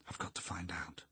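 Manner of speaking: hushed, urgent whisper to oneself; whispered thought. A narrator's reading. Eleanor hidden in the shadows, thinking in a whisper. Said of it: "she was thinking".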